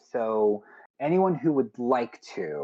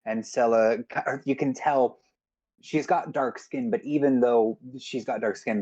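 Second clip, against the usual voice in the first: ordinary delivery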